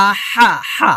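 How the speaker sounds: "আহা" with h squeezed from mid-throat; pressed throat h. Shown as a demonstration. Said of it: "খলকের মাঝখান থেকে চাপ দিয়ে".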